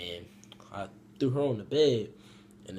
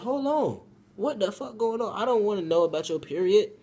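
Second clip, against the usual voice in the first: high, loud comic protest; alarmed objection; 10 semitones higher, 6 dB louder than usual. Retold or imitated. Teller stops the girl talking about her period, loud and appalled. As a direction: cut her off in high-pitched disbelief.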